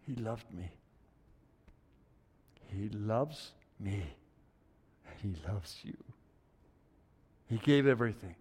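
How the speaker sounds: tender hush. Real, not acted. Preacher quietly telling them God loves them. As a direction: speak God's love gently. Tender close on God's love.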